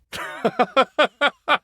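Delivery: high, helpless giggling; ticklish laughter. Acted. Sulker tickled by a companion, laughing helplessly. Being tickled while sulking.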